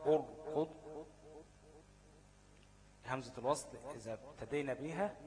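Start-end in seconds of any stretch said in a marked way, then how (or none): 0.1-0.7 أرقد said louder, with a full, heavy r after the u; the teacher gives أرقد as an example of a heavy r. Shown as a demonstration. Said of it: "نحو".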